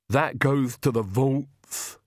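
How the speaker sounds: slow, odd, heavy servant's voice; Igor, slow and mournful; Igor's grieving voice. A narrator's reading. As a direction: drag each word out in grief.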